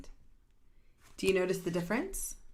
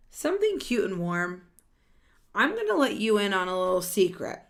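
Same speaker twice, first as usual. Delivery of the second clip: louder, lively read-aloud of a character line; animated storybook voice; 3 semitones higher, 7 dB louder than usual. Acted. Reading a picture book to children, animated and louder. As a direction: voice the characters brightly for the kids.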